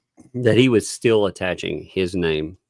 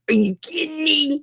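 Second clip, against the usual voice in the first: a much higher, incredulous mock yelp; mock outrage. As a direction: squeak it out in disbelief. [name] reacting to police warning.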